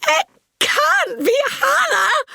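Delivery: high, slow, piercing disbelief; shrieked denial; shocked scream. Retold or imitated. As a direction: scream it in horror. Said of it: "I screamed".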